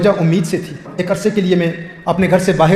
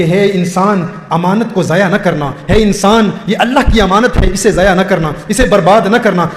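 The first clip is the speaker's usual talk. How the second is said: ordinary talk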